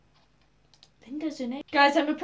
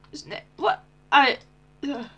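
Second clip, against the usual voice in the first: ordinary talk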